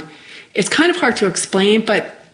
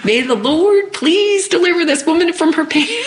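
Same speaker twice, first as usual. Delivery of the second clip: Southern preacher praying; 11 semitones higher than usual. Retold or imitated. Preacher prays over injured crash victim, mock Southern preacher. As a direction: wail a mock revival prayer in a Southern accent. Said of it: "Ma'am, I'm a preacher".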